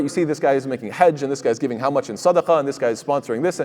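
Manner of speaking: normal talking voice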